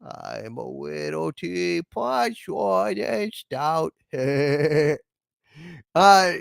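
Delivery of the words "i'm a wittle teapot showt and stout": slow, soft cartoon hunter with w for l and r; lisping cartoon voice ending in a chuckle; Elmer Fudd. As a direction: sing it slowly as a tongue-tied cartoon hunter. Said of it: "And Elmer Fudd sings".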